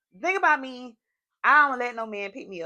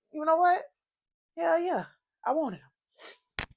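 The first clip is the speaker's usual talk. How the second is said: excited; 8 semitones higher, slower than usual. Real, not acted. Gushing over crush.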